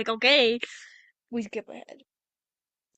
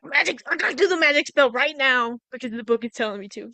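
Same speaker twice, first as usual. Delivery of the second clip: excited, louder burst, the end rushed; eager rush; 7 dB louder than usual. Retold or imitated. Elphaba eagerly rushing to cast the spell, excited. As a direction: blurt it out, swept up by the book.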